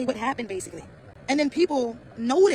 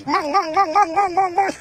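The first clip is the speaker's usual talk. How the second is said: louder rhythmic mock slapping sound; loud mong noise; 5 semitones higher, 8 dB louder, slower than usual. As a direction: bang out "mong" like a drum, louder.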